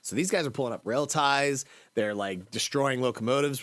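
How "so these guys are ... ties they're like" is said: ordinary talk, nothing marked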